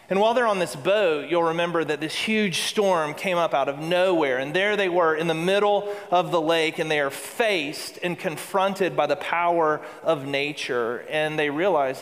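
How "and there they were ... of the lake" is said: usual speaking voice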